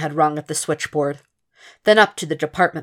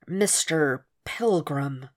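slow, softer sigh stretching every syllable; sighed, drawn out; 5 dB quieter, much slower than usual. Acted. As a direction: drag out each syllable to stall the caller. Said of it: "Will sighed, trying to draw out".

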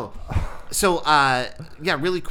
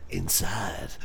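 sexy whisper; 5 semitones lower, 4 dB quieter than usual. Pretend.

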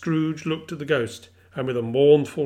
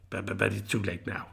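soft, halting mutter; muttered regret; 5 semitones lower, 6 dB quieter than usual. A narrator's reading. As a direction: sigh it out, too late. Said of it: "Scrooge muttered".

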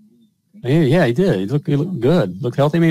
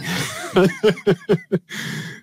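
real laugh; 8 semitones higher than usual. Real, not acted. Husband laughing at his own shrinking joke, real laughter. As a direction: crack up at the punchline.

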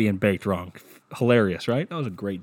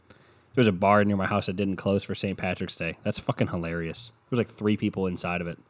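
usual speaking voice

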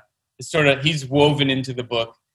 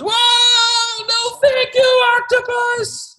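very high, louder sung-out protest, slow at the end; shocked boy; 3 dB louder, much slower than usual. Acted. Boy blown by tuba, high singing protest. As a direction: wail it up high like a startled kid. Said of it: "And the boy says".

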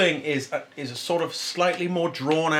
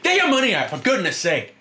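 higher and louder, fed-up retold outburst; exasperated yell; 10 semitones higher, 4 dB louder than usual. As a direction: snap at them to hurry up. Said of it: "I'm like".

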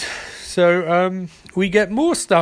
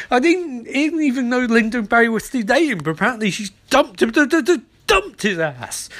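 excited; 6 semitones higher than usual. Real, not acted. Shocked at breakup news.

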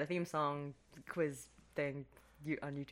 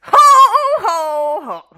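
Santa laugh; 12 semitones higher, 23 dB louder, much slower than usual. Retold or imitated. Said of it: "That wasn't right either".

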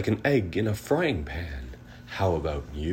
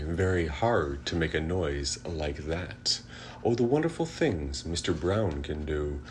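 usual speaking voice

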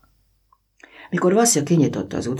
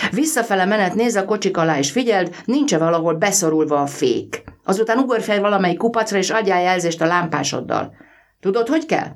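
engine driver's voice; 7 semitones higher than usual. A narrator's reading. Driver instructing boy, voiced.

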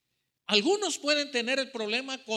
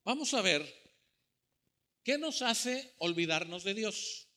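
usual speaking voice

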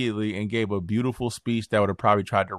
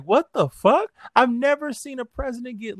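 high, disbelieving voice; incredulous; 14 semitones higher than usual. Real, not acted. Incredulous rant.

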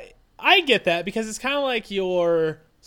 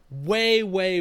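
usual speaking voice

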